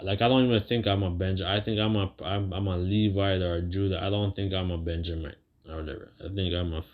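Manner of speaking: ordinary delivery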